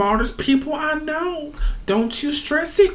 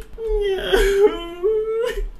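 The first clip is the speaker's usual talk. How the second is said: high, wailing sob; stressed student sobbing; acted crying; 7 semitones higher than usual. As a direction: cry in a high whimper. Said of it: "Why are you crying?".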